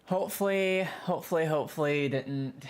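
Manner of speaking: high, loud pleading; pleading hope. Real, not acted. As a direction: beg the game to have saved. Praying the save survived.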